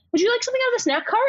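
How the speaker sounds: high, fast flight attendant sing-song; chirpy attendant. Retold or imitated. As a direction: rattle it off high and quick like cabin crew. Voicing flight attendant's chirpy offer.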